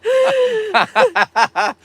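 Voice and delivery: loud high cackle; loud laugh. Real, not acted. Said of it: "That was great".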